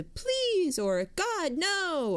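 high, drawn-out imitation of begging; mock pleading. A narrator's reading. Narrator guessing at victims' pleas in the notebook, mock pleading.

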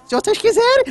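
ordinary talk, nothing marked

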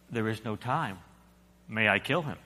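low, soft, steady angel voice; a quiet, unhurried angel; calm angel. A narrator's reading. Angel insists.

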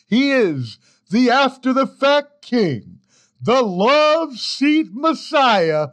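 high, slow booming announcer; grand announcer intro. Acted. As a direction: hype the intro like a ring announcer. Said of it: "ending outtake".

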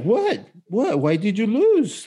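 high-pitched mocking kid; gloating. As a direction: taunt the loser in a high voice, imitating a gloating child. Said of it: "he was gloating and laughing and, and, and going like".

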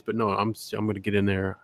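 low, soft creepy come-on; mock-courteous creep, voice dropped and hushed; polite creep. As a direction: murmur it like a well-mannered lecher.

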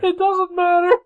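high, loud, laughing objection; exasperated protest. Real, not acted. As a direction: squeal it in disgust.

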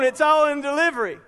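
usual speaking voice

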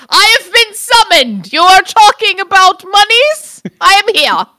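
high, loud, pompous declamation; mock grand Jacob. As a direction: announce yourself like a summoned finance wizard. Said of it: "Jacob's like".

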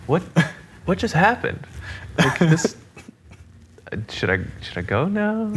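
high-pitched, halting confusion; mock bewilderment. Drummer left alone in boss's office, comically bewildered. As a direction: stammer it out high, as if stunned and unsure.

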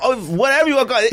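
ordinary talk

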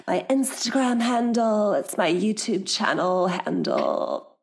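a lightly put-on, slowed promo voice; half-joking ad-read voice for the handle; playful mock announcer plugging her channels, slowed down; fake voice. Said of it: "That's my fake voice".

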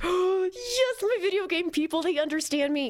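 high, overjoyed fanboy; excited gasp. Retold or imitated. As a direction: gush with delight, gasping. Gasping delighted fan impression.

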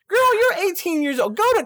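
high exasperated outburst; exasperated scolding. Acted. Scolding the character Winnie.

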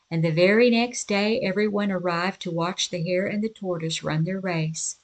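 ordinary delivery

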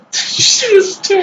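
high, slow laughing echo of the tomb joke. Real, not acted. Mocking echo of friend's joke.

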